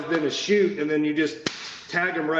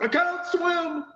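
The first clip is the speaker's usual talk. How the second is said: mock panicked yell; 13 semitones higher, slower than usual. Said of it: "and he's like".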